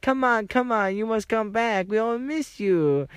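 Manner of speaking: high, pleading mock voice of the cruise worker; imitating cruise worker. Cruise worker coaxing teen back to the group, high mock plea. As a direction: mimic her begging him to come back. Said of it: "She's like".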